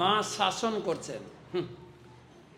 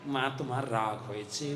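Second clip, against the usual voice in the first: put-on tender child; 6 semitones lower, 5 dB quieter than usual. Child cuddling mother.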